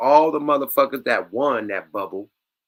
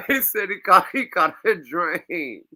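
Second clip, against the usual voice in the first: laughing disbelief; 8 semitones higher, 3 dB louder than usual. Real, not acted. Laughing retelling guest line.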